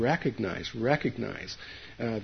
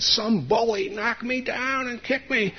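put-on much higher, slow, whiny child voice; little kid's fib; 11 semitones higher than usual. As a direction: play the hurt little boy covering up. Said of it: "instead I said".

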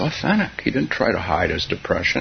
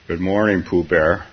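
lightly voiced gloomy donkey, a touch lower; a hint of a mopey Eeyore greeting Pooh; 5 semitones lower, 3 dB louder than usual. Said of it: "said Eeyore gloomily".

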